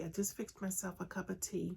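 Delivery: ordinary talk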